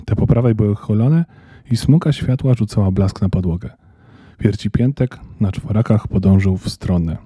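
ordinary delivery, nothing marked

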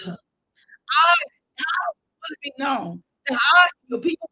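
preacher voicing Moses pleading with God; pleading preacher's Moses. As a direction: beg God on Moses' behalf.

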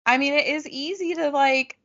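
usual speaking voice

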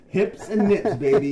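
loud, high sleazy tease; mock seductive. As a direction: flaunt your hips. Gyrating to turn him on.

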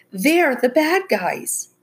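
pleading. A narrator's reading. Becca still pleading with ranger.

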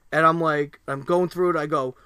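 ordinary talk, nothing marked

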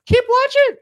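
very high, loud, drawn-out squawk; comic yelled command; mock urgent command. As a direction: shoot way up in pitch, urging them on. Sudden mock command to viewers.

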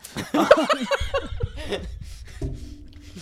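breathless silent laugh; wheezing laugh. Real, not acted. Laughing at the taste.

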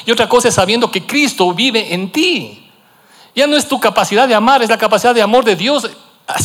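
high, loud climactic preaching; impassioned preaching. Acted. Pastor urging the church that Christ lives in them, fervent and loud.

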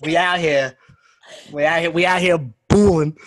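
loud New York street slang; hammed-up New Yorker; New York accent.

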